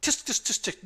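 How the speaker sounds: high tsk tsk tsk; tutting. Acted. Midwife tuts at the hurt player, high tutting. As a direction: tut disapprovingly like a fussy nurse. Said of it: "she goes".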